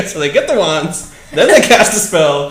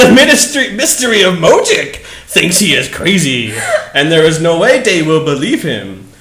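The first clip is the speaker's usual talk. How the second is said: ordinary delivery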